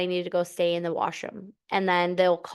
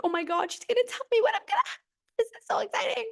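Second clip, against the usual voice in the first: much higher, fast, giddy squeal; excited squeal; faster than usual. Retold or imitated. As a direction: gush like news is finally coming. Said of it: "and I'm so excited".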